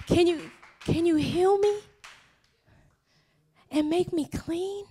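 acted desperation, held back and unsure; desperate plea. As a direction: hesitantly beg for a healing. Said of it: "the desperation in his voice".

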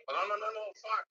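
sudden high, loud dismay; dismayed outburst. Real, not acted. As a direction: blurt it out as the bid is lost. Outbid in the auction.

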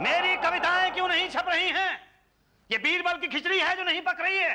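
high-pitched aggrieved outburst; indignant shouting. Acted. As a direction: cry out your complaint in a high voice. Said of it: "क्यों चिल्ला रहे हो?". (ऊँची आवाज़ में चिल्लाकर शिकायत)

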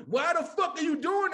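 high-pitched exasperated outburst; exasperated demand. Retold or imitated. Viewer confronts the character on screen, exasperated. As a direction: demand an explanation, high and incredulous.

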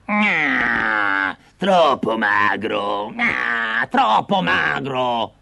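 wailing, slow witch lament; whining witch. Acted. The witch feels the bone and wails he's too thin. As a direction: moan like a disappointed hag. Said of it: "si lamentò".

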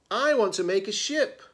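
high, loud, quick insistence; insistent. A narrator's reading. Simone argues with Meg over what to build, insistent. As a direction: insist on it like a child in a squabble. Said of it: "Simone insisted".

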